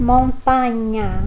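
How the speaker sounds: montanha with a clear nh sound; Italian gn sound. Shown as a demonstration. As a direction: read montanha with gn as nh. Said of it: "você vai ler".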